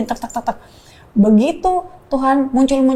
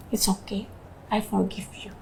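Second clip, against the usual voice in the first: much softer, tender words of pardon; soft forgiveness; 14 dB quieter than usual. Retold or imitated. Wife quietly forgiving her crying husband, soft and tender. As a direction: let it out gently, barely above a breath.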